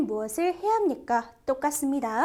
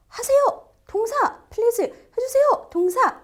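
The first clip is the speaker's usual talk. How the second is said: high, loud playful command calls; emphatic commands; 9 semitones higher, 6 dB louder than usual. Acted. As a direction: belt out each command like a cheerleader. Teacher acting out commands. (높고 크게 신나게 외치는 명령)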